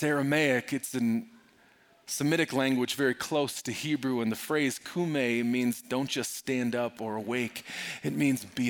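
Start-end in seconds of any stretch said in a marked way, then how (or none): none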